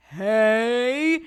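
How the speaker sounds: loud high startle shout; a big jump-scare hey. Retold or imitated. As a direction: bark it out to spook the kid. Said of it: "Daddy, do it louder".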